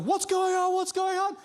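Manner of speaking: high, loud panicked shout; alarmed dad. Retold or imitated. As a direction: imitate a dad bursting into a war zone. Dad bursts in, war zone.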